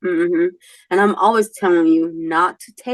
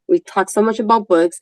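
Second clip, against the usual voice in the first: ordinary talk, nothing marked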